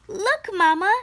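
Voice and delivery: high, loud eager call; excited cry. Acted. Jamila shows Mama her decorated shoes, excited cry. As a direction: call out proudly for attention. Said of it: "cried Jamila".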